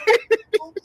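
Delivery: high-pitched cackle; real laugh, high and sudden; high burst of laughter. Real, not acted. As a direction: break into a squealing laugh. Laughing at own joke.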